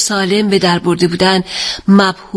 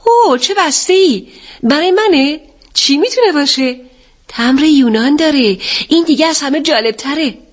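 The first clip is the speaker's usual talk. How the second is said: higher-pitched excited exclamations; excited cry; 6 semitones higher than usual. A narrator's reading. Daughter to father over a parcel from Greece, excited cry. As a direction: cry out with eager curiosity. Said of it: "اتل فریاد زد".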